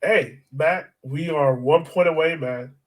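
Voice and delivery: usual speaking voice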